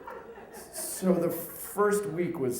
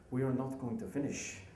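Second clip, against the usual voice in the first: put-on softer, hammy French client accent; bad French accent; 8 dB quieter than usual. French client warning the consultant halfway through, bad French accent. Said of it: "Sorry, my accent sucks".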